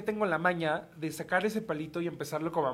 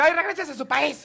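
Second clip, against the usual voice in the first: high, loud mocking snap back; mock angry retort; 7 semitones higher, 6 dB louder than usual. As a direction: fire back, hamming up the anger. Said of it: "Y yo".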